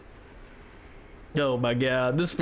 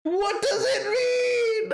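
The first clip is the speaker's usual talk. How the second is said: high, slow, shouted with a cracking cry; 13 semitones higher, slower than usual. Real, not acted. Streamer shouts in disbelief at the baffling donation message. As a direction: yell it out in disbelief.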